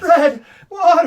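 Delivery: very high loud laughing shriek; shrieking psychic. Retold or imitated. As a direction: shriek it in mock terror. Psychic line repeated laughing.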